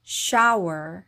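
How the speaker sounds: "shower" blended; "shower" birleşik okunur; English shower. Shown as a demonstration. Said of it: "shower이라고 발음하시면".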